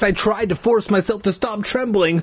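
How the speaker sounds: ordinary delivery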